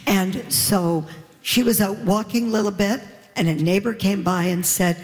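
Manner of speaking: ordinary talk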